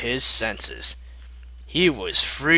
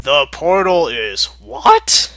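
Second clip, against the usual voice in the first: baffled question; 4 semitones higher, 6 dB louder than usual. Real, not acted. Confused reading reaction.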